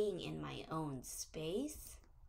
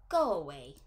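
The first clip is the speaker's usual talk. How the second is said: mock angry; 4 semitones lower, 10 dB louder, faster than usual. Shown as a demonstration. Acting out unkind words.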